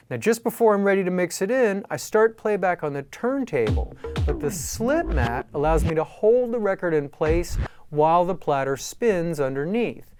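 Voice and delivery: ordinary talk, nothing marked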